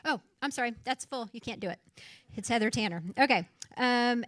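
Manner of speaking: normal talking voice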